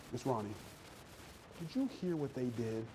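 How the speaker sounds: hushed, conspiratorial gossip; gossipy undertone. Acted. Chatty Cathy confiding in Miss Ronnie, hushed gossip. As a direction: lean in softly with the scandal.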